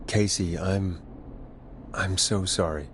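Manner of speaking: low, halting and sorrowful; sombre condolence. Acted. Host consoles bereaved caller, sombre. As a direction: offer sympathy, pausing for words.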